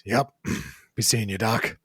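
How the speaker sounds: terse goodbye, kept low; Riggs. Acted. Injured man leaving the doctor, low and terse. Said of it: "Riggs pushes himself with his good arm".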